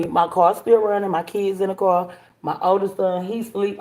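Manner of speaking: ordinary delivery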